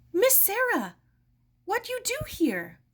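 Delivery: put-on high, loud old woman's surprised question; elderly woman's question. Mrs Ito startled to see Sarah in her parlor, high and loud.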